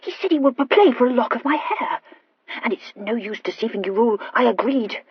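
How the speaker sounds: stammering Horatia. Acted. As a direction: confess eagerly with a nervous stammer. Horatia confesses.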